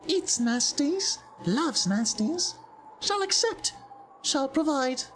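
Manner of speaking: high-pitched, evenly held creature voice; Jin, bargaining creature. Acted. Jin asks a visitor for an offering, high stilted creature voice. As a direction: speak high and stilted, like an odd little trader.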